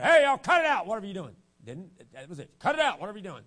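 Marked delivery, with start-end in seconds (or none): 0.0-1.3 much louder, higher call from up a ladder; hollering down. Retold or imitated. Father on a ladder hollers at the kids, loud. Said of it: "he hollered down and said". 2.6-3.4 retold holler. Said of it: "I don't even think he was that loud".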